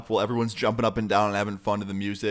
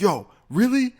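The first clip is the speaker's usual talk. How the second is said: much higher, louder disbelief; amazed; 10 semitones higher, 4 dB louder than usual. Retold or imitated. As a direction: gasp it out, hyped. Friend amazed at the news.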